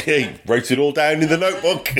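high-pitched, amused aside through laughter; real giggle breaking into the line; laughing. Real, not acted. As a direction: laugh your way through the words. Laughing at own notes aside.

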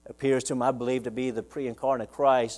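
ordinary delivery

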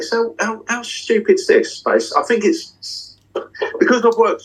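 fighting back a wave of real emotion; getting emotional. Real, not acted. Proud magician tells interviewer about his tour, voice catching with emotion. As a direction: voice rising high and slowing as emotion catches. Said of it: "I'm getting a bit emotional".

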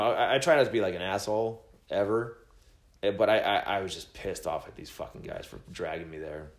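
ordinary talk, nothing marked

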